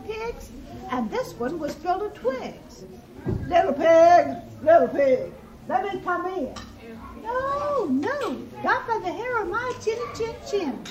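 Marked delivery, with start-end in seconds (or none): 3.5-6.5 loud wolf call, easing off at the end; demanding wolf. A narrator's reading. Wolf at second house. 7.2-10.8 higher-pitched piglet refusal; defiant little pig. A narrator's reading.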